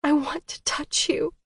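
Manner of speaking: breathy intimate whisper. A narrator's reading. Whispering her wish to touch Colton. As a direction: barely breathe the words. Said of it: "I whispered".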